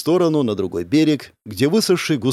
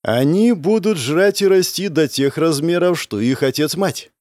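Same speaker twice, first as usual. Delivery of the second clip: plain reading voice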